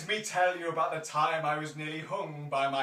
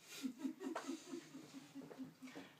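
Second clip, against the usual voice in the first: high thin giggle in a put-on voice; playful high-pitched giggle; creaky high giggle; 8 semitones higher, 14 dB quieter than usual. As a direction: giggle like a mischievous creature. Rose creature giggles.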